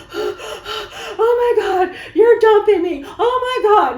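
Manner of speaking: high, loud, drawn-out panic; hammed-up breathless despair; frantic panicked wail. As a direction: wail it like the world is ending. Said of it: "barely able to breathe".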